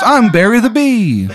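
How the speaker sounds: very high, squeaky little bee; chirpy high insect voice; tiny bee voice. Acted. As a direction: pitch way up like a tiny bee talking. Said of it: "He goes".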